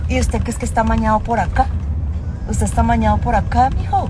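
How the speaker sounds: hammed-up Medellín Colombian accent, inviting warmly. Greeting a Bogotá fan, she plays a Colombian host in accent. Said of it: "A ver si me sale el acento".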